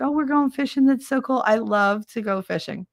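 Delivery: excited friend. Retold or imitated. Friend keen to go fishing, excited. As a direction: mimic a friend delighted about the plan.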